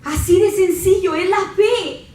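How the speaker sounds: high, loud preacher's cry; fiery preaching. Acted. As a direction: preach it with fire. Preaching at full force. (predicación a todo pulmón)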